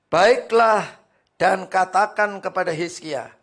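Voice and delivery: loud, high scripture reading; full-force preaching. Acted. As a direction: proclaim it to the congregation. (membaca ayat dengan suara khotbah lantang)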